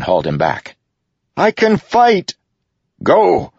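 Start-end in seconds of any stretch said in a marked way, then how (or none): 1.4-2.3 high, loud, desperate insistence; shouted protest. A narrator's reading. Renarin protests being held back. 3.0-3.5 loud, high-pitched command; bellowed. A narrator's reading. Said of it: "Dalinar bellowed".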